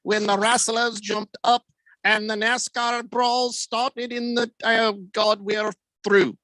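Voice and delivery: hammed-up sermon voice; mock preacher. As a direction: preach the end times for laughs. Mock end-times sermon.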